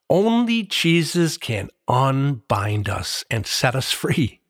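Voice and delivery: ordinary talk, nothing marked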